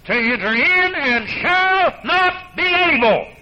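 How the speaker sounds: high, loud, slow pulpit proclamation; preaching at full force. Acted. Preaching climax, scripture quote.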